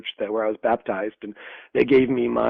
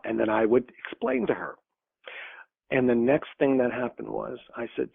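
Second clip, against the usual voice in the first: ordinary talk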